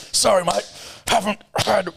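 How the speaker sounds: high, slow, sheepish fake apology; mock boss. A boss punching staff excuses himself, sheepishly mocking. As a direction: apologise like it's nothing. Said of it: "your boss just calls you".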